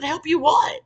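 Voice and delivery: high, loud indignant retort; retold yelling. Coworker answers the crying girl, retold indignant yell. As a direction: throw it back at her, high and loud. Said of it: "yelled at her".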